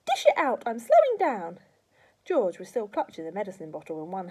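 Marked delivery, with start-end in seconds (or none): 0.1-1.6 loud screechy crone; impatient demand. A narrator's reading. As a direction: urge him on, greedy. Grandma demands more dose.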